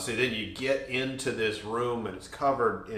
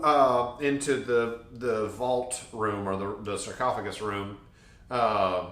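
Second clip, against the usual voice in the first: usual speaking voice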